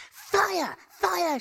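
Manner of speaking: high shrieked cry of Fire; screaming fire. Retold or imitated. Old woman waking in the dumpster, shrieking alarm. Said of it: "she cried".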